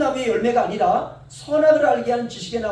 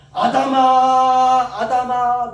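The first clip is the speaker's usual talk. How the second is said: long drawn-out call, much louder; 3 semitones higher, 8 dB louder, much slower than usual. Acted. God still calling sinful Adam, drawn-out loud call. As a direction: call out the name across a garden.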